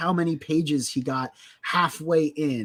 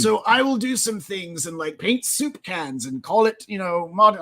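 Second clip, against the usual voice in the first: higher, mock British pretentious artist; British accent; 6 semitones higher than usual. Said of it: "why I gave him a British accent".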